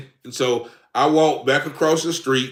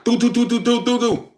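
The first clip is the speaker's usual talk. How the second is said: imitated door knocking; 8 semitones higher than usual. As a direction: tap out the police knocking with your voice. Police keep knocking.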